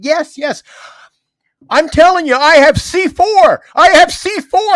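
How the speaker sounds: high, loud, insistent yelling; insistent shout. Retold or imitated. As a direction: yell it stubbornly. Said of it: "Kept saying".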